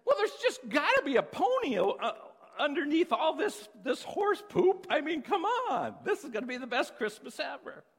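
put-on high, loud, laughing boy; excited kid. Optimistic twin explains to parents, giddy and laughing. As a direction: bubble over with glee.

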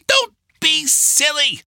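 put-on high-pitched scolding old woman; old woman's warning. A narrator's reading. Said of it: "the old woman warned".

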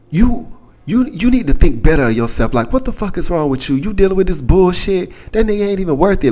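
high-pitched imitated tirade, not shouted; mock screaming friend. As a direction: mimic a fired-up friend scolding. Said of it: "she started screaming in, like, this voice".

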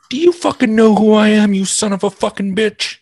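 high, loud hammed-up fury; mock outraged shout. Comedian imagining his retort to a rude comic, mock furious shout. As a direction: bellow it like an indignant star.

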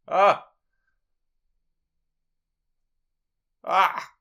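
sharp cry as the drops sting; pained yelp. Real, not acted.

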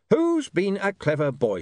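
high, slow, mock-sweet praise; sarcastic cooing. Saccharissa greets William sarcastically, mock-sweet. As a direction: coo it with sarcasm, drawn out.

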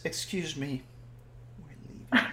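tiny hushed voice fading into a whisper; very soft, retold near-whisper; barely audible. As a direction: imitate someone saying it almost without sound. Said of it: "Just, like, barely audible".

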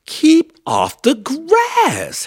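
very high, drawn-out loud shout. A narrator's reading. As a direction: shout the order slowly at full force. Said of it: "Hank shouted".

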